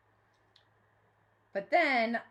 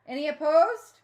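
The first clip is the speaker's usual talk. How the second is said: loud, high call to the room; calling the vote; 6 semitones higher, 13 dB louder than usual. A narrator's reading. The mayor calls for nays, loud and high. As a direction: project it across the chamber.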